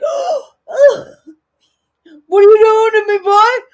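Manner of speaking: high, loud mock squeal of a man in pain; imitating panicked man. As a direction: shriek it like the bitten guy crying out. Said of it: "He's like".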